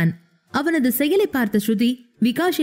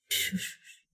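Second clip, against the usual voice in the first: soothing shush; 15 dB quieter, much slower than usual. Acted. Hushing crying Sruthi.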